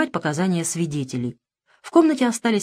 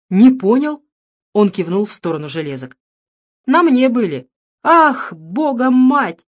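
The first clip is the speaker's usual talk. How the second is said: angry; 4 semitones higher, 7 dB louder than usual. Acted. Father outraged at handcuffs.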